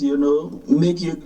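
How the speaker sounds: ordinary talk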